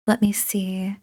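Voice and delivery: murmured whisper. Acted. Tutor reading the answers, whispering. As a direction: whisper while reading it over. Looking over the work.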